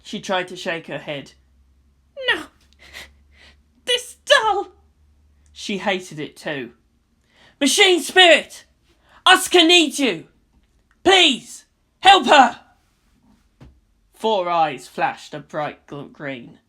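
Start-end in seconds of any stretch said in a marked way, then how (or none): 2.3-4.7 high, crying denial; tearful girl. A narrator's reading. Girl's anguished denial. 7.6-12.6 raised, louder begging cry; loud desperate plea. A narrator's reading. Shinji begging loudly.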